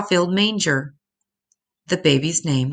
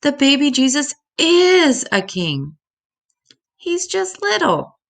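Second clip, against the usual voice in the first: very high, small star voice, softening at the end; a tiny star explaining with wonder; Little Star's voice; 11 semitones higher, 3 dB louder than usual. A narrator's reading. Little Star telling the other stars about baby Jesus, very high and full of wonder. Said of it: "Little Star cried out".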